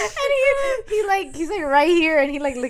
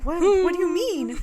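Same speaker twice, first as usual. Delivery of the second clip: high-pitched startled question; mock alarm; 6 semitones higher, 4 dB louder than usual. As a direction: play the rattled girl, voice lifting. Acting the frightened girl.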